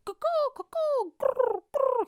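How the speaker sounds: very high bird-like cooing and trill; drawn-out high cuckoo with rolled trills; imitated signal call. Guy signalling the others to attack, imitated high bird call.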